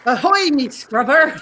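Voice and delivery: mock pirate hail, half laughing; pirate accent. [name] answers the quiz in a pirate accent, hammed-up. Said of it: "same question in a pirate accent".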